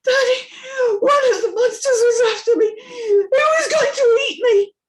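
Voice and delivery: put-on high, loud crying little girl; sobbing child. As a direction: sob out the nightmare to Daddy. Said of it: "Amy sobs so hard".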